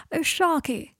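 delighted high cry; cried out. A narrator's reading. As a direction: cry out fondly. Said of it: "cried Draco".

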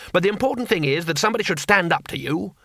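ordinary delivery, nothing marked

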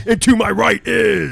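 loud, drawn-out announcer call; announcer shout. Acted. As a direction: boom it out like a ring announcer. Announcing co-host loudly.

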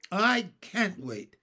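plain reading voice

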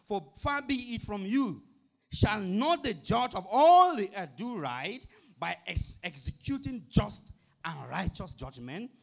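Abraham raising his voice to beg the Lord, slow and loud; pleading Abraham. Acted. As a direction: plead slowly and loudly, as Abraham before God.

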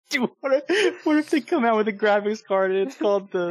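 talking through real laughter, high-pitched; laughing. Real, not acted. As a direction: squeal the idea out while cracking up. Cracking up at own joke.

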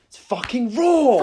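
loud, slow, drawn-out yell; shouting. Acted. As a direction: bellow the word raw. Shouting catchphrase for emphasis.

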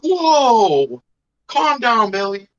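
high, loud startled whoa, then calm down; jumpy surprised reaction to the kid. Retold or imitated. Retelling being startled by a kid.

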